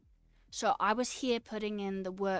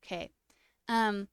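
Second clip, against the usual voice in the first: ordinary talk, nothing marked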